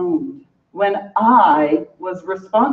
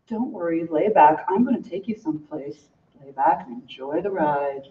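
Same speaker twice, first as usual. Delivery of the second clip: soothing seducer; 7 semitones lower, 6 dB quieter than usual. Acted. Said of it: "kind of force".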